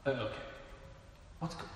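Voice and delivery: ordinary talk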